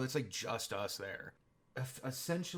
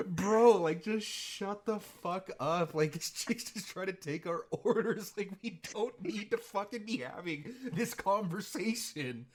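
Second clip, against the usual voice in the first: exasperated mock scolding; 8 semitones higher, 5 dB louder than usual. Retelling telling him off.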